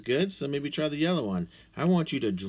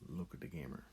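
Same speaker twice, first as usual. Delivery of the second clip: much softer, low murmur; soft aside; 8 semitones lower, 17 dB quieter, much faster than usual. Acted. Teacher softly nudging viewers to look at the camera, much quieter. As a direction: hush it like a secret to the viewer.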